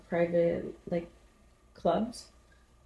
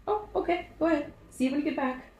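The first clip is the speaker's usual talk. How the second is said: much higher, sweetly innocent send-off; mock innocent; 9 semitones higher, faster than usual. Sending the friend outside to the snake, mock innocent. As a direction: play dumb, knowing about the snake.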